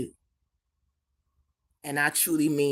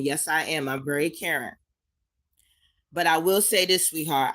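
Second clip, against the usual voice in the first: usual speaking voice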